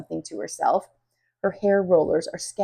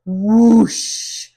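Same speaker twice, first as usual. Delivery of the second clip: whoosh sound; 3 semitones higher, 11 dB louder than usual. Acted. Bird swoops past.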